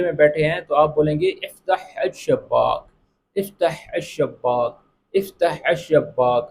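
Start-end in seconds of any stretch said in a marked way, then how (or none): 1.3-6.5 slow افتح with a breathy throat ḥa; clear Arabic ḥa sound. Shown as a demonstration. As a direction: stretch out افتح and let the ح in افتح come from the throat. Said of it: "ہا کی آواز نکالی ہے". (افتح میں ح کی آواز حلق سے صاف اور آہستہ نکالیں)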